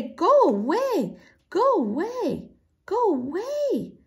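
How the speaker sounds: high, drawn-out bird-like squawk; furious squawking. A narrator's reading. Robin drives the girls off, furious squawking. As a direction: squawk each phrase high, swooping down, slowly. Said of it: "squawking furiously".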